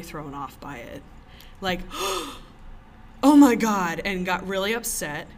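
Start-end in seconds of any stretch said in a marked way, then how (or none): 1.9-3.2 sharp high gasp of alarm; a startled intake of breath; shocked gasp. Retold or imitated. Shocked woman at card trick. 3.2-4.0 loud, shocked "Oh my God"; an offended diner crying out; shocked exclamation. Retold or imitated. Shocked woman at card trick.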